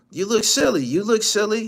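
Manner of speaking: high, loud mocking yell; mocking shout. Real, not acted. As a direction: yell it at him in disbelief. Repeats roast much louder, higher.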